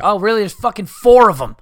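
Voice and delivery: mock the one who won't pick a flavor; sarcastic exasperated shout. Real, not acted. Mocking ice cream indecision.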